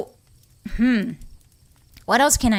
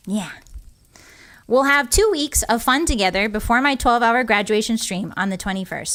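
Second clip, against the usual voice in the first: ordinary talk, nothing marked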